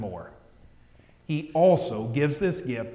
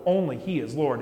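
normal talking voice

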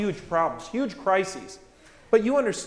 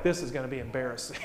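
low, quick, soft muttered worry; embarrassed aside; 6 semitones lower, 9 dB quieter, faster than usual. Retold or imitated. Disciples about to hand out the bread, embarrassed aside. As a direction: mutter it fast under the breath.

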